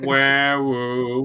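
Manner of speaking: long, high wailing siren imitation; mock ambulance siren. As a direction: wail like a crybaby siren.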